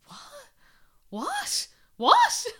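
the penny drops, voice climbing each time; dawning disbelief. Retold or imitated. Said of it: "saw the penny drop".

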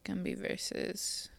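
hushed whisper. Real, not acted. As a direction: whisper it softly. Whispering case name.

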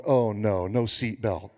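low, deflated acted dismay; sinking realization. Driver realizing he forgot his seatbelt, low mock dismay. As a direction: let the voice drop as it dawns on you. Realizes why he was stopped.

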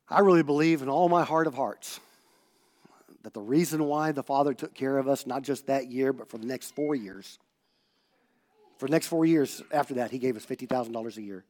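normal talking voice

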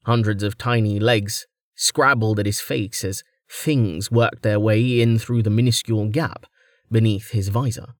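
ordinary delivery, nothing marked

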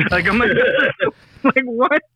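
high, laughing "what?"; laughing at a silly movie line, pitched high; laughing disbelief. Real, not acted. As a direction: laugh it off in confusion.

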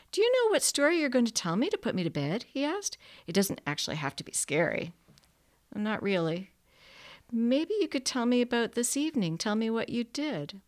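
hint of a little boy, coaxing and a bit wary; lightly voiced small boy. A narrator's reading. Small boy asking the narrator for a bedtime story, lightly voiced.

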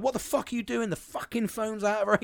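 mock angry rant. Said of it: "I'm like".

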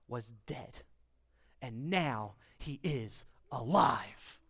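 hushed awe. Acted. Father telling servants his son lives, hushed and slow. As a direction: drop to a near whisper, slow and low. Father proclaims son's return.

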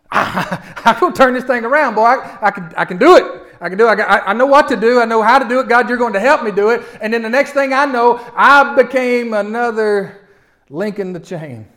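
loud, high, impassioned preaching; full-force preaching. Acted. Pastor preaching to the congregation at full force. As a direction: drive it home to the congregation.

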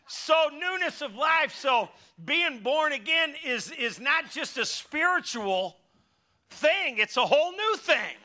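preaching. Acted. Preacher returns to sermon, high, loud and slow. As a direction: build it up to the church. Raised preaching after laughing.

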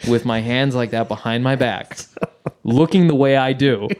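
usual speaking voice

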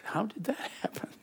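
a wry whispered thought with a laugh; hushed laughing whisper; whispered aside. Retold or imitated. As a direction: whisper it to yourself, amused. Inner thought, joking.

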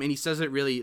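ordinary delivery

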